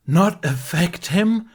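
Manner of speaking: high, loud, slow disbelief; incredulous. A narrator's reading. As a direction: repeat it in disbelief. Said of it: "repeated incredulously".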